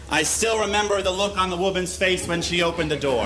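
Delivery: normal talking voice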